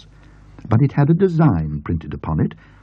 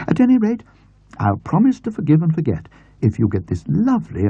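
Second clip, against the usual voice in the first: put-on higher, brisk elderly aunt; aunt's voice; 6 semitones higher than usual. A narrator's reading. Aunt promising James forgiveness, lightly voiced. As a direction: forgive him as the bustling aunt.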